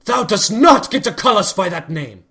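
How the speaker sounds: loud, high, venomous outburst; furious royal rebuke. Acted. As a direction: lash out with wounded anger. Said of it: "the venom and anger lacing Celestia's voice".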